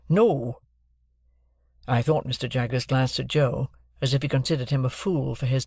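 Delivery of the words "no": a firm, slightly louder no; stern. A narrator's reading. Joe refusing the lawyer's money, mildly stern no. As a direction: plant the word like a stubborn blacksmith. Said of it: "returned Joe sternly".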